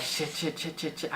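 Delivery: very soft repeated cursing; retold quiet fretting; muttered panic. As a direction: curse over and over under your breath. Retold panic with minutes left.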